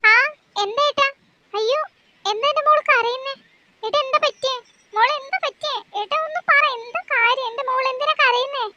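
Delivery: a fretting mother begging for answers; worried mother. Acted.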